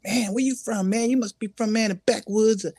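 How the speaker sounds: high-pitched imitation of curious listeners; astonished listeners quoted. Listeners asking the player where he's from, mock astonishment. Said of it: "they go like".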